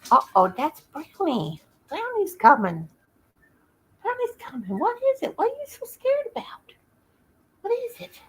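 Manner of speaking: high sing-song voice for a scared dog; pet talk. Real, not acted. Talking to frightened dogs.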